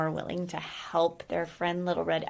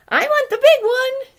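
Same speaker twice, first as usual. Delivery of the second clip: very high, very loud squealing hare; greedy scream; 14 dB louder than usual. Acted. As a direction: shriek it like a greedy child. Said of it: "screamed the hare".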